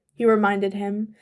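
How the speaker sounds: usual speaking voice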